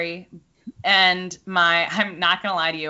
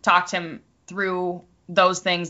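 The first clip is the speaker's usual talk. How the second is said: ordinary talk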